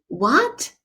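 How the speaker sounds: "What" sweeping up high; rising tone. Shown as a demonstration. Teacher showing second tone, "What" rising in disbelief. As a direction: ask an incredulous "What" with a steep rise. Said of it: "It's a rising tone".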